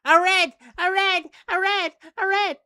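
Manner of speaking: very high, loud squealing critter; excited creature cry. Acted. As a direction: shriek like an alarmed little creature. Creature cries out repeatedly.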